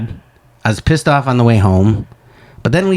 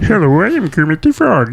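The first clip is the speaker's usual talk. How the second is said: higher, puppet-frog voice; Kermit the Frog; 5 semitones higher than usual. Retold or imitated. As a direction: greet like a cheerful felt frog. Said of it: "Does that sound like Kermit the Frog".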